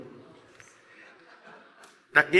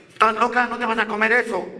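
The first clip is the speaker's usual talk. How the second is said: higher-pitched mock scolding in Spanish; retold scolding of a dog, pitched up; dog owner scolding; 6 semitones higher, faster than usual. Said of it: "I pull back and I tell her".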